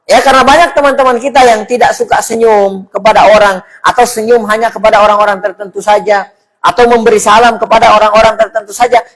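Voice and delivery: ordinary talk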